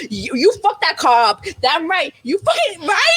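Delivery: rising, fired-up yelling; mad. Retold or imitated. Viewer cheering on the car wrecking, mad and rising. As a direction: build to a high, heated shout. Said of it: "I was mad".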